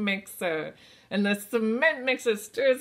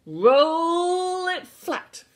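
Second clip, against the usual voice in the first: slow, louder, stretched call; slow drawn-out call; 8 semitones higher, 8 dB louder, much slower than usual. Shown as a demonstration. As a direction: draw the words out slowly. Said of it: "Let's do it together".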